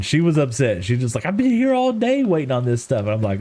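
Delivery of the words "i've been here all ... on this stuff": higher-pitched, hammed-up irate customer; mock Karen complaint. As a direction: imitate the wife going full Karen, pitched up high. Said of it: "she was going full blown Karen".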